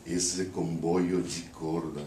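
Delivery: poem recited in Brazilian-accented Portuguese, phrase by phrase. Shown as a demonstration. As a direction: recite with a Brazilian accent, pausing between verses. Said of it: "read the poem with a Brazilian accent".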